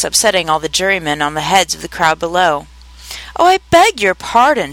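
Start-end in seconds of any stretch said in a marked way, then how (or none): 3.4-4.7 high, emphatic cry of dismay; great dismay. A narrator's reading. Alice apologizes after upsetting the jury box, greatly dismayed. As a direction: exclaim it higher, as a dismayed apology. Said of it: "in a tone of great dismay".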